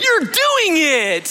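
high, slow, sing-song praise; encouraging. Retold or imitated. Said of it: "an encouraging, like".

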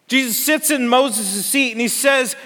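usual speaking voice

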